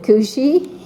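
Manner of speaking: ordinary delivery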